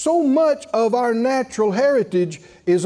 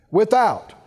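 ordinary talk

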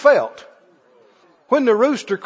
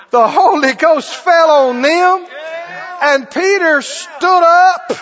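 loud, slow and higher sermon climax; slow, loud revival preaching; full-force preaching; 5 semitones higher, 5 dB louder, slower than usual. Real, not acted. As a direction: preach it with fire.

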